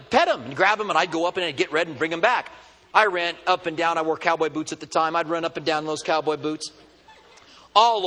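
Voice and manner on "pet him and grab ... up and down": ordinary delivery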